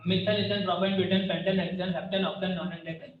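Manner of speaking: quick-fire recital of the list; fast recital. Shown as a demonstration. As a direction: rattle off the names quickly, no pauses. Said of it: "और फटाफट याद रखना है". (तेज़ी से फटाफट बोलना)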